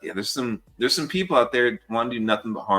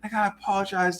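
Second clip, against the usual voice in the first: higher-pitched put-on remorse; contrite apology; 8 semitones higher than usual. Girl suddenly apologizes after fight.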